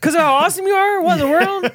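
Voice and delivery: high, loud incredulous squeal; mock disbelief. Friend playing along with the story, high incredulous squeal. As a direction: act shocked and baffled.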